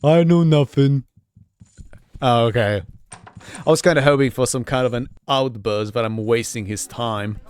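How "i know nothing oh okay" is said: dopey cartoon bellow. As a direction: shout it like a clueless oaf. Player voices dim-witted dialogue choice.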